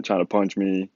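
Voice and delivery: ordinary delivery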